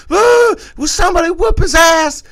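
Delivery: high, loud whiny cry for help; imitating a crying woman begging; mock wail from the slapped woman; mock wailing plea. [name] voiced crying for help.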